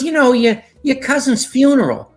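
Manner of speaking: put-on high-pitched Boston mom accent. Mother reminding son of a family funeral, Boston accent. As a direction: imitate Mom's Boston accent, pitched well up. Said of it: "she says in that Boston accent".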